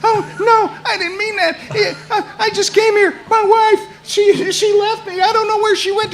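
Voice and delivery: high, pleading whimper of a big man gone small; shrinking cowboy. Retold or imitated. Cowboy pleading with the furious woman, high whimpering apology. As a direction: cower and beg, voice squeezed high. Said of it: "giant cowboy starts to shrink".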